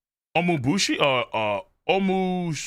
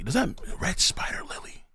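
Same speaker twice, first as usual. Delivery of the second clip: breathy unvoiced whisper; hushed reading; 6 dB quieter than usual. Real, not acted. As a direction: whisper it to yourself. Reading manga aloud, whispered.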